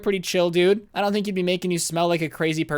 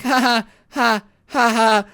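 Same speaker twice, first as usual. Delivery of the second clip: forced nervous ha-ha, pitched up; nervous laugh; 6 semitones higher, 4 dB louder, slower than usual. Retold or imitated.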